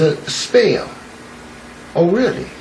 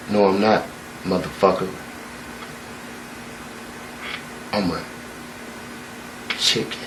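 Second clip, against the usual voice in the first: sullen mutter; 8 semitones lower, 16 dB quieter than usual. Acted. Young eagle sullen refusal.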